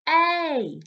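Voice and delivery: A said as a clear letter sound; phonics sound A. Shown as a demonstration. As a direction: model the sound A for a child. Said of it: "point at it and go".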